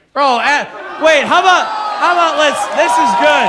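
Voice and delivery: real high-pitched, very loud yelling; loud burst of hollering; excited shouting. Real, not acted. Excited shouting at close of set.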